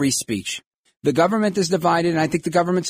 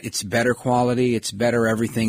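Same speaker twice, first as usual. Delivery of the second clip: normal talking voice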